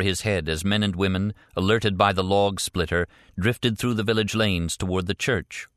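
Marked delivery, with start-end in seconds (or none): none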